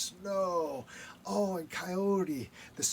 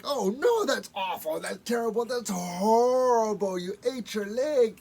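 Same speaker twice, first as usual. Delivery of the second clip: horrified; 3 semitones higher, 7 dB louder than usual. Acted. Coyote horrified at Raven.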